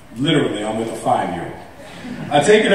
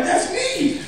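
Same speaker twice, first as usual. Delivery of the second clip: proud high squeal; 5 dB quieter than usual. Retold or imitated. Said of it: "I was like".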